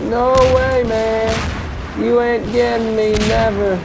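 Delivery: riding a winning streak, taunting; excited taunting. Real, not acted. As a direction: crow it out high and cocky. Taunting enemies while winning.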